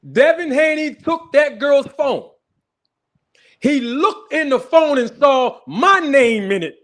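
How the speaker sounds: slow, high, loud shouted reveal; gleeful shouting. Real, not acted. Streamer gleefully shouting his big reveal to viewers, high and slow. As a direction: trumpet the punchline.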